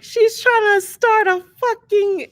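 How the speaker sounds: high, loud mock-outraged voice; exaggerated accusing squawk; mock accusation. Mocking critics' imagined accusation.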